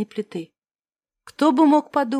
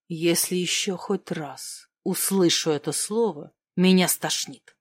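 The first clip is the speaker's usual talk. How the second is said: зло; 7 semitones lower, 5 dB quieter than usual. Acted. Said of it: "зло бросил Бек".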